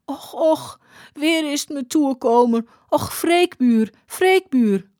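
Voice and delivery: high, plaintive acted lament; wailing. A narrator's reading. Woman seeing her sorry state, acted tearful wail. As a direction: wail it high, drawing out the name. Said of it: "begon ze te huilen en riep".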